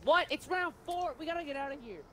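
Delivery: high-pitched, startled alarm; freaking out. Real, not acted. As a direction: panic at suddenly realising the danger. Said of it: "Why are you freaking out?".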